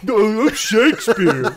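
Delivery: dumb Shakespeare. Acted. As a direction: play Shakespeare as a big idiot. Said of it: "what if Shakespeare was like".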